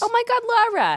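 hearing a friend's name, delighted surprise; excited surprise. Real, not acted. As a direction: gush over the name. Surprised recognition of wife's name.

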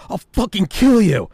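high, loud mock-furious outburst; furious threat. Friend pinning a kid to the wall, retold as furious yell.

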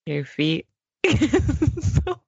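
slow meditation voice cracking into giggles; mock guided meditation. Host guiding co-host into sand, slow and giggly. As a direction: draw it out, fighting laughter.

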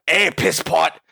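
mocking a thick Northern Irish accent, calling out; a hammed-up Northern Irish mentor jeering. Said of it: "really thick Northern Irish accent".

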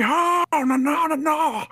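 high whiny "Oh, no, no, no"; mock crying no. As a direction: wail it like a sore loser. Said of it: "make him say".

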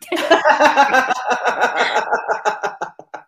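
pained, wheezing laugh; laughing through pain. Real, not acted. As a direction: laugh that hurts to do. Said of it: "Oh, it hurts to laugh".

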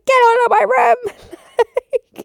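very high, loud, drawn-out scream. Retold or imitated. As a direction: scream it slowly at full pitch. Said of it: "It was so intense".

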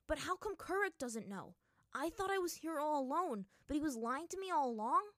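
loud, high, indignant questioning; angry. A narrator's reading. Aang realizes Kuruk may have lied, angry. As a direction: build outrage at being lied to. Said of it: "Then he became angry again".

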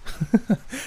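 short high laugh; brief, light acted laugh. A narrator's reading. Davidson asked if he can see his friend, laughing. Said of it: "He laughed".

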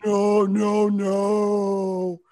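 loud, drawn-out wailing; hammed-up crying villain; mock crying. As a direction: wail each word like a big baby. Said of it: "Darth Vader was crying".

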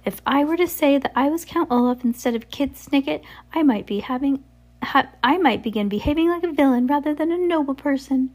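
high, far-off villain falsetto; sly villain in silly disguise; ridiculous high-pitched voice. A narrator's reading. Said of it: "ridiculous high-pitched voice".

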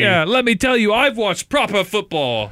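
high-pitched mock British accent; hammed-up British boasting; super British. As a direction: lecture as an over-the-top British football snob. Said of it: "He's super British".

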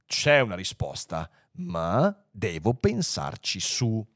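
low voice, slowing right down; pondering computer. Acted. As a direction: weigh each word as a grave machine. Computer answers after pondering. (computer grave e meditabondo)

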